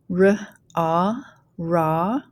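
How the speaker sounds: "ra" built from r and a long ah; teacher blending "ra" slowly; phonics blending. Shown as a demonstration. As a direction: sound out r, ah, then join as "ra". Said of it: "go ahead and start blending".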